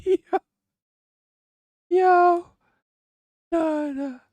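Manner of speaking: a sudden very high "Yo" squeal; excited yelp. Real, not acted. Streamer shocked by the accent clip, high squealed Yo. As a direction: yelp it out in high-pitched disbelief.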